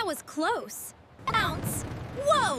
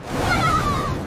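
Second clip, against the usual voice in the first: high, loud scream of alarm; 9 semitones higher, 7 dB louder than usual. Acted. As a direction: shriek right before impact. About to crash.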